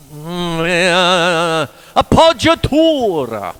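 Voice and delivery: loud, high, hammed-up Italian accent. Shown as a demonstration. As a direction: put on your best big Italian accent. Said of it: "do my best Italian accent".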